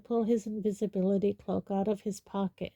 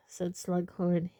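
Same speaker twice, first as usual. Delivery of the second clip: plain reading voice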